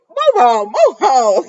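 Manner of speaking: high, loud sing-song "move on"; mock shout. Vlogger telling the characters to move on, high and loud. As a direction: shoo them along in a loud high voice. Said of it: "I said".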